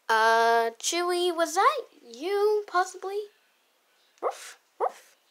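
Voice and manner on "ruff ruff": soft barking. Pretend. Dog answering quietly.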